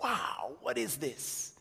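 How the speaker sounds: hushed, wide-eyed wow, swooping in pitch; softly awestruck, as a kid seeing it first; retold amazement. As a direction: drop to a soft, marvelling wow. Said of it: "We're like".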